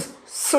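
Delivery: a soft, voiceless hissed स; just breathe out a hissing स; hiss demo. Shown as a demonstration. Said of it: "हिस का sound निकलता है". (धीमी, फुसफुसाती हिस वाली स ध्वनि)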